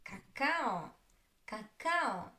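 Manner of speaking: ka-KA-o, second "a" of "cacao" long; stress on second syllable. Shown as a demonstration. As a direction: lean on the middle syllable of "cacao". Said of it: "the second".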